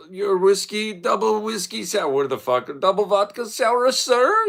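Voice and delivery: high, loud mock waiter voice; hammed-up waiter announcing the drink. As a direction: play the server as a squeaky caricature. Waiter voiced in retold story.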